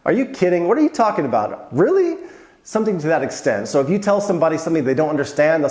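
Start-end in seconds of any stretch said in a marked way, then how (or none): 0.1-2.2 high, disbelieving "really"; incredulous really. Retold or imitated. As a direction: jump up in disbelief. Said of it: "would be something like".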